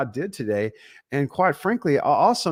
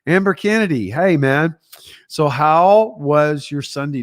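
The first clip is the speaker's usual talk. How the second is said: louder, beaming hello to a friend; loud happy greeting; 3 semitones higher, 7 dB louder than usual. Real, not acted. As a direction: welcome a viewer by name, loud and delighted. Greeting a viewer by name.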